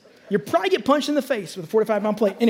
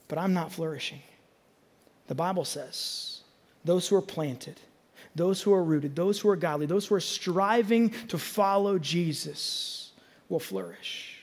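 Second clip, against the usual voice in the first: much softer and lower, slow; hushed preaching; 4 semitones lower, 7 dB quieter, slower than usual. Acted. Pastor drawing listeners in, dropping to a hushed sermon voice. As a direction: pull them in close and quiet.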